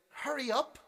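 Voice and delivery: high, loud mock order; pushy lady. Lady orders the musician to hurry, in a high pushy voice. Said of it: "She's like".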